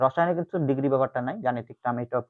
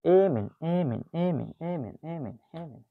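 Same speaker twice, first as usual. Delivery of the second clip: fading repeat; 7 dB quieter, slower than usual. Shown as a demonstration. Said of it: "drilling the word".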